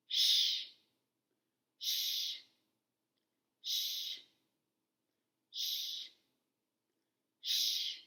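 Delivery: a long unvoiced "Shh" with pauses to repeat; isolated sh. Shown as a demonstration. Teacher prompting children to echo the "Shh" sound. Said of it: "let's say it in isolation".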